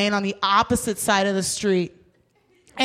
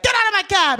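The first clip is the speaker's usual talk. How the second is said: sudden high-pitched mock yell; angry yell; 8 semitones higher, 3 dB louder, faster than usual. Driver throwing her out after the speech, angry yell. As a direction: cut in with an exasperated shout.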